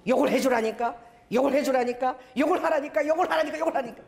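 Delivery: mock pestering command, one on top of another; insistent nagging. Voices the Spirit's insistent repeated command.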